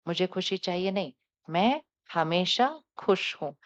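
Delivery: gentle meditative affirmations; guided affirmation. Acted. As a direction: lead the group calmly through each vow. Leads affirmations.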